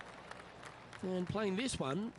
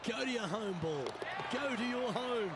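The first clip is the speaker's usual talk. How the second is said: pleading with the putt, higher; urging the ball; 6 semitones higher than usual. Real, not acted. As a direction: coax the ball into the hole.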